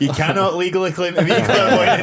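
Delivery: high, loud quip through laughter; laughing. Real, not acted. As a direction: crack up while delivering the joke. Joking amid the group's laughter.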